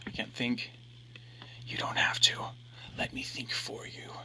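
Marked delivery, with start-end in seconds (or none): 1.7-4.1 unvoiced ghostly whisper; eerie whisper. A narrator's reading. Reflection whispers to him to win her back. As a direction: breathe it like a voice inside his head.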